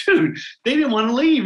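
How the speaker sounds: mock astonishment, lifted high on the first word; retold amazement. Principal marvelling to a friend that students stayed, amazed.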